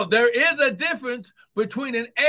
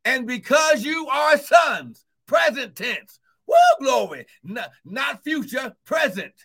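full force preaching; 3 semitones higher, 4 dB louder than usual. Acted.

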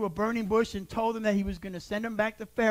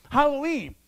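ordinary talk

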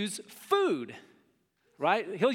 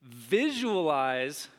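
slow, drawn-out visualize; 3 semitones lower, much slower than usual. Shown as a demonstration. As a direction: stretch visualize very slowly, every syllable. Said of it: "I always say this".

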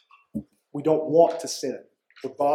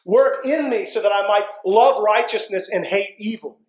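louder and higher, fervent prayer to God; earnest prayer; 4 semitones higher, 4 dB louder than usual. Shown as a demonstration. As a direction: pray it out with urgency.

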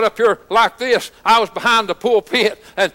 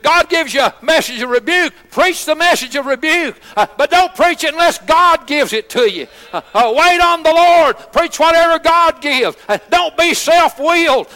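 fiery preaching; 6 semitones higher than usual. Acted. Pastor exhorting congregation, fiery full-force preaching. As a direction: preach it hard to the pews.

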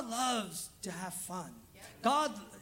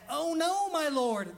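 high wailing start, falling away; dramatic Bible reader; 6 semitones higher, 3 dB louder, slower than usual. Retold or imitated. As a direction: lament it like a theatrical narrator. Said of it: "Just like that".

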